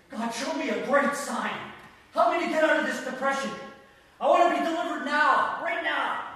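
high, loud cry to God; desperate loud plea. Acted. Preacher acting a depressed believer demanding deliverance, loud urgent plea. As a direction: beg urgently at full voice.

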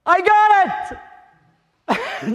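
high, loud, laughing cry; excited quote. Retold or imitated. Preacher retelling friend's phone call, overjoyed high cry. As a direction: burst out with joy. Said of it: "He goes".

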